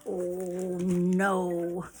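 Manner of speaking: low, drawn-out storyteller dismay; mock dread. Storyteller seeing only one place setting, drawn-out mock dread. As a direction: let it sink slowly with dread.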